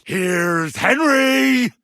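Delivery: a long, slow, high shout of his own name; drawn-out shout. Acted. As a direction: announce yourself like a herald, stretching it. Loud slow announcement of himself.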